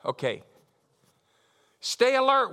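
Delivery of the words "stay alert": emphatic call. Acted. Preacher emphatic scripture reading.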